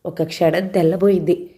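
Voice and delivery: ordinary delivery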